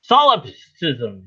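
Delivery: "Solipsism" drawn out slowly as SOL-ip-siz-um; sounded-out pronunciation. Real, not acted. Host checking Google how to say it, slowly sounding out "Solipsism". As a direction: sound out "Solipsism" carefully, stretching each part. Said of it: "how do you say it".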